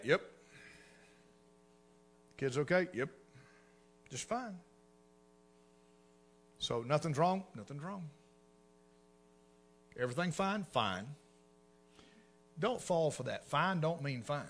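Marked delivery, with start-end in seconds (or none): 4.1-4.6 clipped, icy "fine", much softer; curt cold reply. Retold or imitated. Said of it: "It's called the silent treatment". 7.6-8.0 icy "Nothing's wrong", much softer; cold denial. Retold or imitated. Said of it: "It's called the silent treatment". 10.7-11.1 "Fine." dropped low and soft, frosty and final; icy fine. Retold or imitated. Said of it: "When fine is spoken in that tone".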